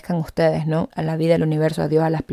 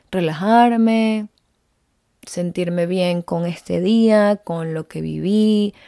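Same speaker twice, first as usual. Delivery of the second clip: slow, savoring; much slower than usual. Retold or imitated. Savouring the calm.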